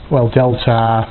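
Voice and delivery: usual speaking voice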